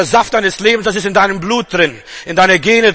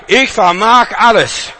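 louder, slow and emphatic; slow proclamation; 3 semitones higher, 5 dB louder, slower than usual. Acted. Preacher hammers the verse home slowly and loudly. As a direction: lean on each word, proclaim it.

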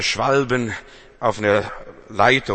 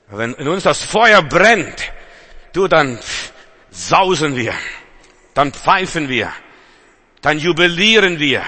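fiery preaching; 5 semitones higher, 6 dB louder than usual. Acted. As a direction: build the fire until you're shouting. Preacher builds to fiery climax.

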